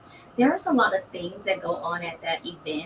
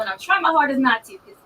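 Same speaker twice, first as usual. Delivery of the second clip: normal talking voice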